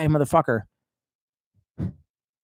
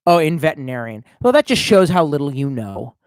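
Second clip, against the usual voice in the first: ordinary delivery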